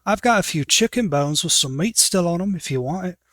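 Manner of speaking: dwarf prisoner offering scraps; dwarf woman. Acted. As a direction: offer the bones like a rough jailmate. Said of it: "But you hear".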